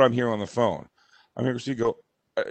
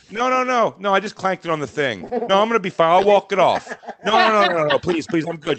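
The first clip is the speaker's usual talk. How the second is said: higher, louder hurried refusals; mock frantic protest; 5 semitones higher, 3 dB louder than usual. Wife waves off people on the street, mock frantic protest. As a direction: insist you're fine, hammed-up panic. Acts out waving off helpers.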